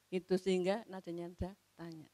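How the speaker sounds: ordinary delivery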